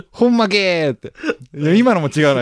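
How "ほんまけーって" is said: high, fake Kansai accent; botched Kansai dialect impression; bad Kansai accent. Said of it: "今の関西弁じゃねえもん".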